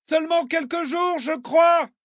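put-on very high, strained girl's shout. A narrator's reading. Girl answering the drifting kayaker, shouted. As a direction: pitch it high to carry over water. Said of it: "cria-t-elle".

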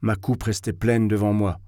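ordinary delivery

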